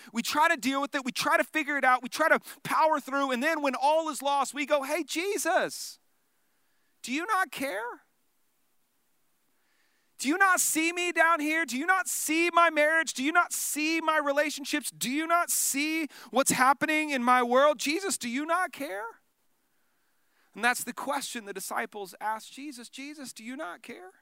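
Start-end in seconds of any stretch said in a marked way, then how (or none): 4.8-19.0 rising, pleading cry to Jesus; anguished prayer, high and pressed; desperate plea. Acted. 22.9-24.1 very soft, almost breathed question; quiet, lingering plea; hushed question. Acted. Quoting disciples again, softly.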